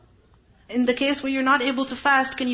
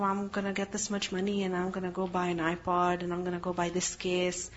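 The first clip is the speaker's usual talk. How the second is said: put-on quiet, lower daydreaming child voice; softly voiced kid rattling off wishes; dreamy kid; 4 semitones lower, 7 dB quieter than usual. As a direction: murmur a kid's big spending plans. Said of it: "their kids are like".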